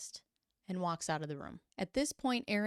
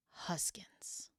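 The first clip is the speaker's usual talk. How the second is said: whispered name, much softer; 10 dB quieter than usual. Retold or imitated. Anonymous audio file to reporter, whispered. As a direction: breathe the name like a secret.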